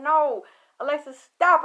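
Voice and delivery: shouting stop, rising to a yell; a friend's loud desperate warning; urgent shout. Retold or imitated. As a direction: yell to hold her back.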